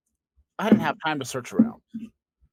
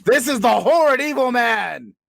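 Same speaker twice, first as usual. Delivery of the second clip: high, loud horrified outburst; outraged cry; 10 semitones higher, 8 dB louder than usual. Acted. A man recognising his old enemy, crying out in outrage. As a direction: spit out horrid evil man.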